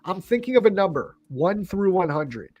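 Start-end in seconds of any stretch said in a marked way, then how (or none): none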